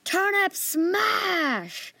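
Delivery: drawn-out, very high shout; slow, loud, high war cry; shouted battle cry. Acted. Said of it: "I shouted as loud as I could".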